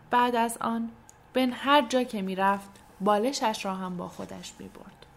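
ordinary delivery

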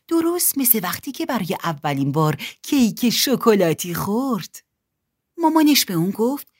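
ordinary delivery

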